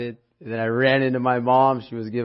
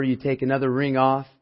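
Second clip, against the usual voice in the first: ordinary talk, nothing marked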